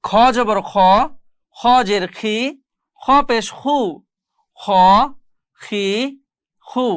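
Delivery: খ, খি pronounced with a full, heavy KH; thick heavy KH. Shown as a demonstration. Teacher drilling students, খ pronounced thick. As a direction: round "খ" heavily, vowel toward O, not A. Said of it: "যেগুলোকে মোটা করে পড়তে হয়".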